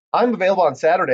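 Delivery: quick, thrilled announcement. Acted. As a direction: rush it out in delight. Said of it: "very thrilled".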